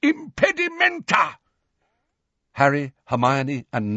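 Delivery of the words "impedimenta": high, triumphant spell yell. A narrator's reading. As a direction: hurl the incantation out in a high cry. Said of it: "both yelled".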